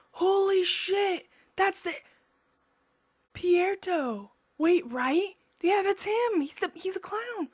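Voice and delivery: high, loud, amazed outburst; excited disbelief. Real, not acted. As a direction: blurt it out, floored by the discovery. Excited realization of the character.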